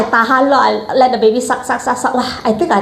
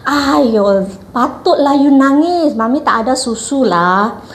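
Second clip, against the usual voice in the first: high, drawn-out mock baby talk; imitated nurse's sing-song sympathy; nurse cooing to baby; 5 semitones higher, slower than usual. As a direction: coo in pity over a crying baby, slow and high. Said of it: "she took the baby away from me and she said".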